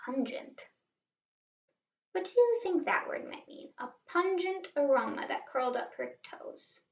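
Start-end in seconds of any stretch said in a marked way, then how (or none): none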